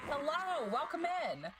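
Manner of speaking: high, sing-out greeting; bubbly welcome. Real, not acted. Streamer greeting viewers as they arrive, bubbly high welcome. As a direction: pitch it up to welcome newcomers.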